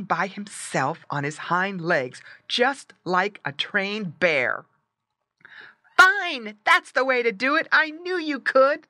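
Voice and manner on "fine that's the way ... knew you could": high, loud cheer; excited, high-pitched shouting; delighted cry. A narrator's reading. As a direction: burst out with praise as a boy. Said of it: "the boy cried".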